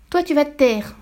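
coupe sèchement, plus aigu et plus fort; riposte furieuse. A narrator's reading. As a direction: fais taire l'enfant avec colère. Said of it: "riposta madame Verdebois". (la mère fait taire Mathilda, vexée et furieuse)

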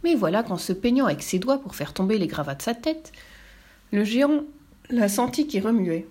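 usual speaking voice

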